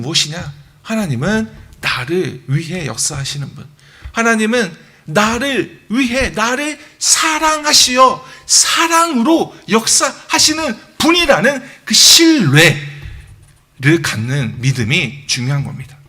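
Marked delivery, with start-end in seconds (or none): 4.1-12.8 drawn-out, loud, high-pitched emphasis on each word; emphatic preaching. Real, not acted. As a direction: preach it slowly and forcefully, voice climbing. Preacher drives home the core belief. (힘주어 길게 강조하는 설교)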